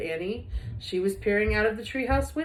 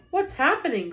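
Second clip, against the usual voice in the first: high, loud frightened question; boy crying out in alarm, raised and louder; 7 semitones higher, 4 dB louder than usual. A narrator's reading. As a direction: cry out as the treehouse spins. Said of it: "cried Jack".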